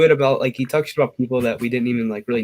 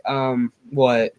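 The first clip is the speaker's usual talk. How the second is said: usual speaking voice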